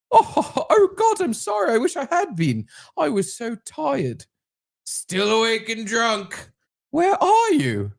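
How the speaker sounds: put-on very high, bubbly English girl voice with a giggle; girl's English accent. Reading the girl's texts aloud in a high, giggly English accent. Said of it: "I'll do the English accent for the girl".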